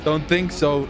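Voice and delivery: ordinary talk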